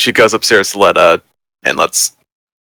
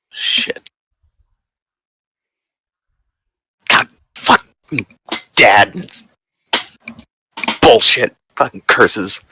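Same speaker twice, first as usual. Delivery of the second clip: muttered curses; slower than usual. Acted. Character mutters curses alone.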